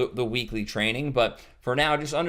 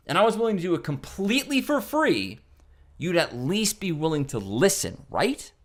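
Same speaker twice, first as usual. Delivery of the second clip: higher, lively, persuasive read; confident engaged pitch; 4 semitones higher than usual. Shown as a demonstration. Caller pitching the owner with conviction. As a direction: sell it with energy and belief. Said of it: "See the difference?".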